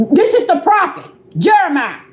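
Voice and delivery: usual speaking voice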